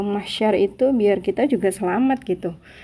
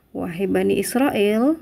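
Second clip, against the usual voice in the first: usual speaking voice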